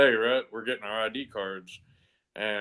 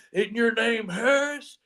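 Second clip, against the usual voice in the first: puzzled drill sergeant; 10 semitones higher, slower than usual. Retold or imitated. Drill sergeant questions recruit.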